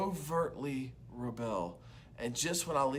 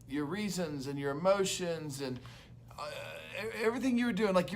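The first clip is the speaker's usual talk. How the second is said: ordinary talk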